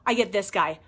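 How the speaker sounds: ordinary talk, nothing marked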